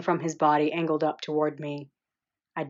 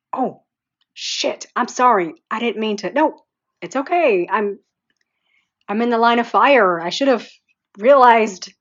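flustered and excited; 6 semitones higher, 8 dB louder than usual. Acted. Flustered apology and her laughing reply.

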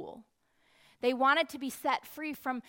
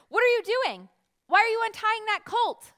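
loud, high challenging question; raised voice demanding answers; demanding bystanders; 9 semitones higher, 9 dB louder than usual. Acted. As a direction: call out suspiciously at them. People challenge the disciples.

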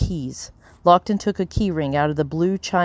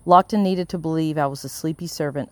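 plain reading voice

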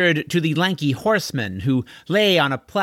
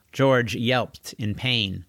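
ordinary delivery

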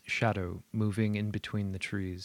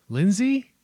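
calling out; 11 semitones higher, 8 dB louder than usual. Acted. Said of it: "John calls out cautiously".

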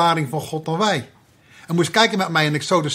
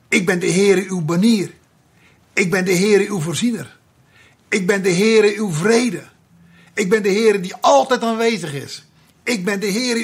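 proclaiming; 3 semitones higher than usual. Acted. Preacher proclaims God's names, building.

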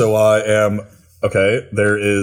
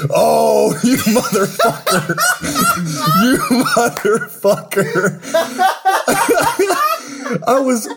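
laughing. Real, not acted. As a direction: crack up in delighted shock. Realises friend's gift plan.

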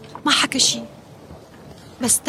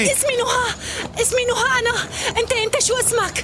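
tearful; 9 semitones higher than usual. Acted. Tearful mother in the water.

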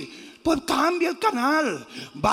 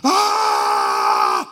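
long loud war yell; battle cry; 7 dB louder than usual. Retold or imitated. Preacher imitates Braveheart warriors charging, long battle yell. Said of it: "esos tipos hacían".

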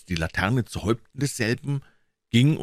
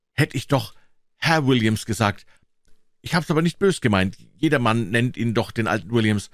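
put-on higher, louder boy's voice, apologetic; worried young Tom, pitched up; contrite boy Tom; 4 semitones higher, 4 dB louder than usual. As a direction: hastily take it back as a worried boy.

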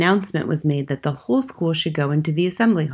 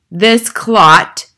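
loud, raised, contemptuous boom; booming denunciation; 5 semitones higher, 10 dB louder than usual. A narrator's reading. Headmistress shows up the boy before assembly, booming. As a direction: boom it out to the whole hall. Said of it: "boomed the headmistress".

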